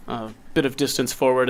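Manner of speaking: normal talking voice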